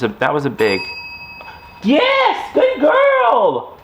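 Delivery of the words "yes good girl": very high, louder yelled praise; shouted praise. Real, not acted. Owner yelling praise as puppy rings the bell again. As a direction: shout your joy at the puppy.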